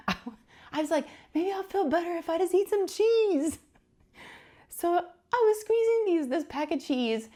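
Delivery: high, giggling through the words; laughing. Real, not acted. Wife laughing about her cheese balls, high giggly voice.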